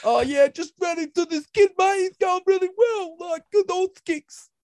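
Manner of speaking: high, slow, dopey boundary rider voice; Dipper impression. Imitating Dipper reporting from the boundary, high and slow. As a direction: drawl it out like an old footy boundary rider. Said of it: "You're gonna be down there like Dipper".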